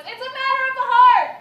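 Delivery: loud, high grand delivery; dramatic declaration. Retold or imitated. Reading her statement, loud and high. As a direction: proclaim it melodramatically. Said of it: "my statement was".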